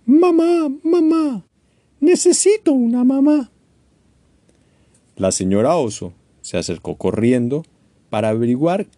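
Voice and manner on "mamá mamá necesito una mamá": high, loud mock crying of a chick. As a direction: wail for a mother. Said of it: "empezó a llorar". (Choco llorando, voz aguda)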